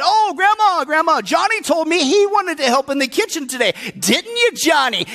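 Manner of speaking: high-pitched, put-on girl's pleading; scheming little sister. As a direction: play the sister sweetly volunteering her brother. Sally wheedles grandma, setting up brother.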